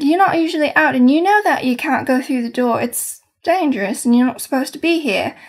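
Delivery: Cockney head chef warning off intruders; broken Cockney accent. Acted. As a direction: warn them in a rough, English-sounding Cockney accent. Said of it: "Cockney, like, Englishy, a-, like, broken accent".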